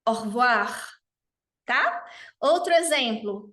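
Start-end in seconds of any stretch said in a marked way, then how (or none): 0.1-0.9 Au revoir com o E caído, quase o hvoa; como nativo. Shown as a demonstration. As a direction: fale Au revoir deixando o E cair. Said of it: "falar como um nativo". (Au revoir nativo)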